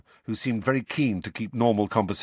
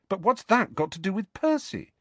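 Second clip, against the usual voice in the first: high-pitched, puzzled question; higher, bewildered query; confused; 11 semitones higher than usual. Acted. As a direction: ask it as if lost. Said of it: "asked Harry, confused".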